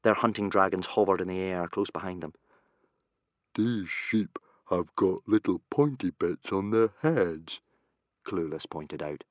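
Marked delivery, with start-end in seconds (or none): 3.5-7.6 put-on slow, puzzled boy voice; dim boy voice. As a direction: say it like a clueless lad. Clueless speaks.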